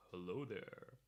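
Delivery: low-pitched, put-on deep greeting. Shown as a demonstration. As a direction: drop the voice down low. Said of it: "do a deep voice".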